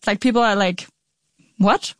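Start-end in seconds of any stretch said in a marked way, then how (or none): 1.6-1.9 mock bewilderment, rising high at the end; baffled reaction. Journalist imitating listeners' reaction to the mystery, baffled. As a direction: imitate someone baffled, laughing a little.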